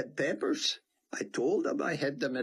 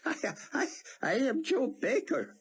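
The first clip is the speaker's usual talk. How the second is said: hammed-up American accent, higher, with laughs breaking in; a clumsy fake American accent, pitched up and giggling; labored American accent. Theon fakes an American accent for Buck, hammed-up. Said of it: "in a ridiculously labored American accent".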